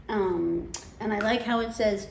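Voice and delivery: usual speaking voice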